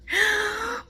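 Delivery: high, emotional gasp of relief; tearful gasp. Real, not acted. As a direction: catch your breath in tearful joy. Overwhelmed by power returning.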